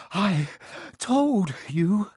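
high, breathless gasp. A narrator's reading. Hermione clutching at the stitch in her chest, gasping. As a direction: gasp out each word with a stitch. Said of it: "Hermione gasped".

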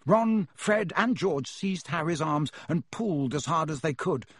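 ordinary delivery, nothing marked

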